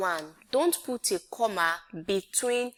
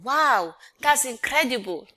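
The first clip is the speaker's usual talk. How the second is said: "Wow, that's incredible" said louder and higher, like real amazement; exclaimed; 5 semitones higher, 6 dB louder than usual. Shown as a demonstration. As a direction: sound truly amazed. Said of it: "It's different from".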